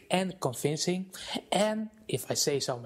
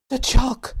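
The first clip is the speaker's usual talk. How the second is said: shrieking, much higher and louder in panic; hysterical scream; 11 semitones higher, 14 dB louder than usual. Acted. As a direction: scream in terror at the moving chalk. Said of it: "So hysterical and shrill was Nigel's scream".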